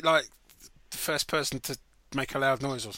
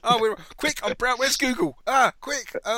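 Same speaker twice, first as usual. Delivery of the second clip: flustered panic; 10 semitones higher, 12 dB louder, slower than usual. Real, not acted. Panicking at a hard quiz question.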